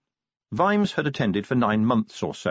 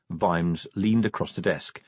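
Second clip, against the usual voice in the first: ordinary delivery, nothing marked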